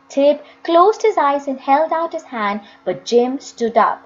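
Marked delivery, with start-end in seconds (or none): none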